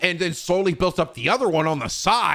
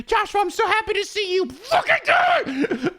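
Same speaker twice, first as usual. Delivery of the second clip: much higher manic hammed-up shout; mock gleeful yell. Player finally catching Joshua, high manic shout.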